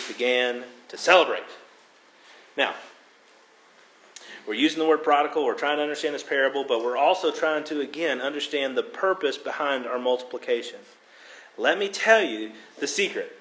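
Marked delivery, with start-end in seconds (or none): none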